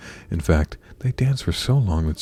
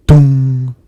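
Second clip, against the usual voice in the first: loud, booming "dong" held on one note; clock chime; 7 semitones higher, 14 dB louder than usual. A narrator's reading. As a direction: imitate a striking clock, ringing out the hum. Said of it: "went the clock".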